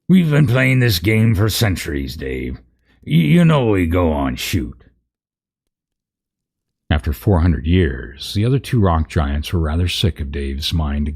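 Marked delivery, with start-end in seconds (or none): 0.1-4.8 exasperated giant. Acted. Giants bickering over game.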